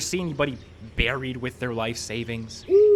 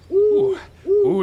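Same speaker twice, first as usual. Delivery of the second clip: high mock owl hoot; owl hoot imitation; 9 dB louder than usual. Man copying an owl at the grave, mock hoot.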